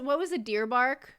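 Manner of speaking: ordinary talk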